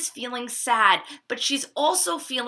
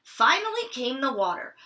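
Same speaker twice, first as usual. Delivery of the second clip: ordinary delivery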